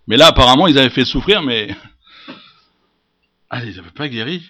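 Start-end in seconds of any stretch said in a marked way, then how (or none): none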